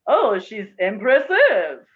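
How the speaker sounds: Oh, she's impressive in a French accent; French accent, slow and drawn out. Shown as a demonstration. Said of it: "if you say it in a French accent".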